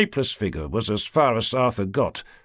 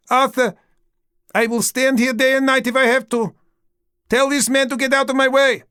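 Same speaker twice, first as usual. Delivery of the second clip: high, loud stubborn demand; wife shouting through the door; defiant shouting; 12 semitones higher, 5 dB louder than usual. Acted. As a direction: refuse to back down, shouting. Queen demanding entry.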